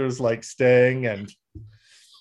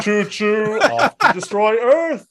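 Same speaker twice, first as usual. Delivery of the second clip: villain voice; 8 semitones higher, 5 dB louder than usual. Pretend. Mock villain train ride.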